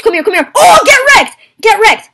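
loud, high gloating yell; taunting shout. Real, not acted. Player gloating over a defeated opponent, taunting shout. Taunting after a game kill.

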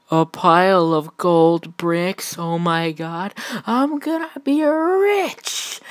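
slow, high gloating, climbing to a squeal; greedy excitement. Acted. Deadpool finding gold bricks, thrilled and gloating. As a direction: build to a high, drawn-out squeal.

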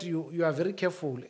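usual speaking voice

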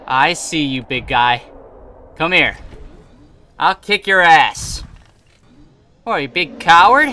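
loud defiant taunts; taunting shout. Real, not acted. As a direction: taunt the monster loudly. Taunting the Reaper in battle.

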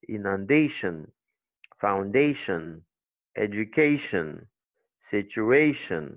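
"inundation, foundation, education, situation" each hit on the syllable before "-tion"; slow word list stressing DAY, DAY, CAY, AY. Shown as a demonstration. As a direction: drill "-ation" words with a strong A and weak "shun". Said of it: "qui doit être toujours accentuée".

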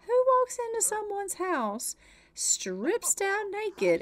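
high scandalized disbelief; incredulous rant. Real, not acted. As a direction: stretch it out in outrage. Ranting about the naked realtor.

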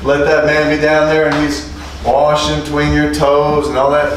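normal talking voice

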